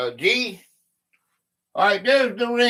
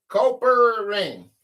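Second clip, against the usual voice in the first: ordinary talk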